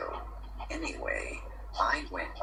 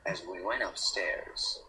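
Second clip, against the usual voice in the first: ordinary talk, nothing marked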